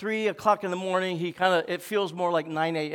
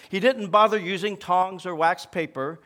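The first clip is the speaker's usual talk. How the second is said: normal talking voice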